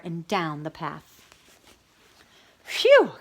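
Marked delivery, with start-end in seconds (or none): 2.6-3.1 high, loud relieved phew; relieved sigh. A narrator's reading. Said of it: "Cow sighed".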